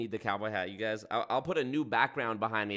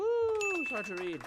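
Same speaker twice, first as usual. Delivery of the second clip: mock squeal; slower than usual. Mock whine about the app.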